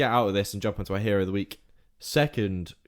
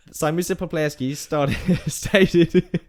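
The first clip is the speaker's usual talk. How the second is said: higher, giggly attempt at reading on; laughing; 6 semitones higher, 6 dB louder, slower than usual. Real, not acted. Teased about the accent while reading, laughing.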